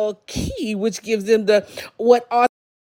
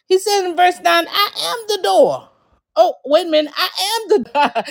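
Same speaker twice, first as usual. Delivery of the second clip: excited; 8 semitones higher than usual. Real, not acted. Preacher exclaims key verse.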